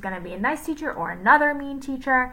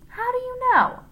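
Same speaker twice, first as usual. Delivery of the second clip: much higher puzzled question; borrowed voice; 4 dB louder than usual. A narrator's reading. Leslie asks Miss Mush, in a higher borrowed voice. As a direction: ask it wide-eyed. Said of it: "she sounded like Paul".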